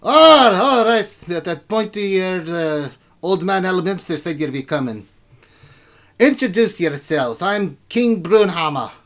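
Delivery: loud, high, blustering dwarf king. Acted. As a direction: greet newcomers as a boisterous dwarven ruler.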